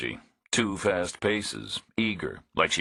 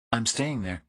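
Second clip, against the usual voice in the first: a dry, wary woman, a touch higher; woman's dry voice; 5 semitones higher than usual. A narrator's reading. Woman complains to Reacher about no signal, dry and higher.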